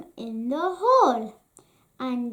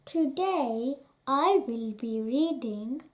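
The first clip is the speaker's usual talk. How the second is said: usual speaking voice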